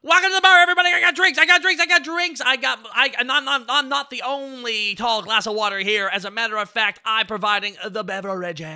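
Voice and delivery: very high and loud, gradually easing down; hollered showman welcome. Pretend. Streamer as bartender welcoming everybody, hollered then easing. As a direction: open at a yell, then settle into showy patter.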